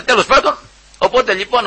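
ordinary delivery

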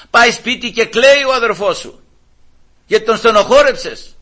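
fervent sermon delivery; full-force preaching. Acted. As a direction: preach it out to the whole church. Preaching to the congregation.

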